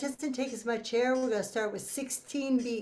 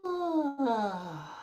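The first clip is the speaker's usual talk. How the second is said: easy yawn sigh. Shown as a demonstration. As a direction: let it out with a yawn. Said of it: "One more".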